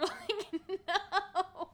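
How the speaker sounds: high, loud laughing no; laughing wail. Retold or imitated. When threatened with peta, laughing wail.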